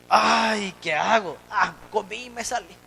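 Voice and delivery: ordinary talk, nothing marked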